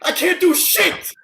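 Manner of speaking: slow, high, raw outburst; anguished cry. Acted. Man with the gun crying out that he is helpless. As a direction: let it tear out of you.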